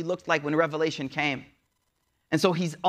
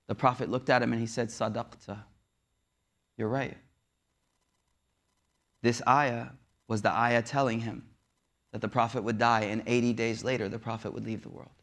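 hushed sorrow; 7 semitones lower, 4 dB quieter than usual. A narrator's reading. Telling the Prophet's coming death.

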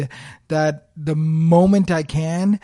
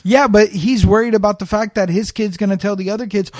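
normal talking voice

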